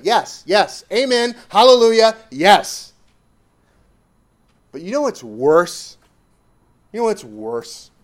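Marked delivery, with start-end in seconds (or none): 0.0-2.9 mock congregation cheer.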